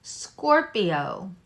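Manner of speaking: ordinary delivery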